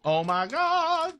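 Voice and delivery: high, slow, loud gush; drawn-out squeal of joy; excited. Real, not acted. Hearing of the new Epic chapter, high excited squeal.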